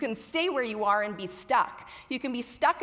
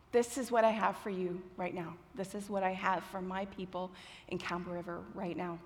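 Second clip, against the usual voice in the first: soft, lightly voiced God; God's quiet answer; 4 semitones lower, 9 dB quieter than usual. God answering her prayer about preaching, soft and measured. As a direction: answer softly and evenly, as God. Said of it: "and God said".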